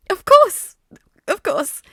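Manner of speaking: high, loud eager yelp; high excited exclaim. A narrator's reading. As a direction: squeak it out in a high voice. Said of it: "his voice notably higher".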